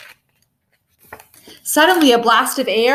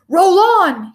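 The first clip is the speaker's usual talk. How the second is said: loud bark, pitched up; 7 semitones higher, 5 dB louder than usual. A narrator's reading. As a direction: snap it out like an angry king. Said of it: "he barked".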